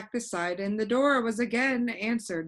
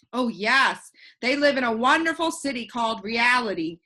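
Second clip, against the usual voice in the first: louder proud announcement; announcing; 3 semitones higher, 7 dB louder than usual. A narrator's reading. Alec tells Milo about the city of Reality, announcing. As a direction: proclaim the city's name grandly. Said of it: "he announced".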